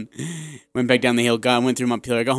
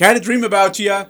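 mock eager coworker; 6 semitones higher, faster than usual. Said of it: "and you're like".

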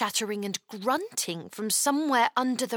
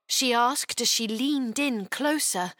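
plain reading voice

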